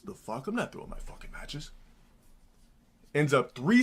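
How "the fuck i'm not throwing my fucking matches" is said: shocked mutter. Retold or imitated.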